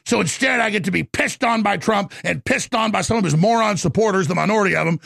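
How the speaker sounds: ordinary talk, nothing marked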